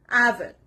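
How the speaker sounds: "avid" stressed on AV, second syllable light; stress on first syllable. Shown as a demonstration. Teacher showing learners where stress falls, first syllable stressed. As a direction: hit AV in "avid". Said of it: "The stress is on the first part".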